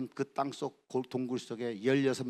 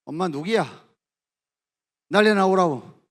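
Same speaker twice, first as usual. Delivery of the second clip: loud, barked interrogation; angry demand; 7 semitones higher, 13 dB louder than usual. Retold or imitated. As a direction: snap at the child to get the mother. Executioner demanding the mother.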